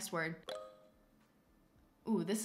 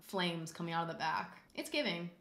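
ordinary talk, nothing marked

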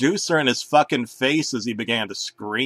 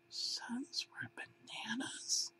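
slow, much higher unvoiced whisper; horrified whisper; 11 semitones higher, 22 dB quieter, much slower than usual. A narrator's reading. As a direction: breathe it in disbelief.

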